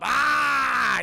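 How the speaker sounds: loud, high "aah"; hammed-up scream. Streamer acting out a movie scene, hammed-up scream. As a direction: belt out a mock movie scream.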